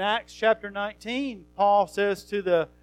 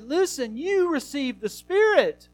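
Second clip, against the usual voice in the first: much higher, rising urgency, pressing the question; urgent pleading; 8 semitones higher than usual. Acted. Paul urging the Galatians who received the Spirit, high and insistent. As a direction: plead with them, pitch climbing high.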